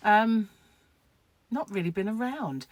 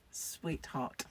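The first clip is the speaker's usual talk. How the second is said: soft thanks; 4 semitones lower, 12 dB quieter than usual. Real, not acted. Thanking a viewer.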